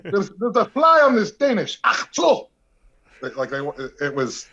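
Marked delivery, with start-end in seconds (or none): 0.0-2.5 put-on high, loud Iron Sheik-style Arabic accent; Arabic-accented wrestler voice, pitched way up. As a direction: belt it out in a thick Arabic accent. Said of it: "see if you could do the accent".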